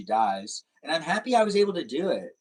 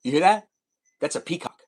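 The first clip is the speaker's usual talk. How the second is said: ordinary talk, nothing marked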